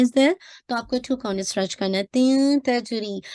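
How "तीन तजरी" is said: तीन तजरी drawn out; light ikhfa on तीन तजरी; slow recitation demo. Shown as a demonstration. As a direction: stretch तीन तजरी slowly. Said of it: "इख्फा का rule है".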